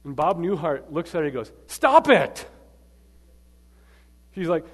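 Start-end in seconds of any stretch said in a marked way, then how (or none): 1.7-2.5 loud, high sudden yell. Retold or imitated. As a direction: yell it at a patient like a fed-up comic psychiatrist. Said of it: "him yelling at this woman".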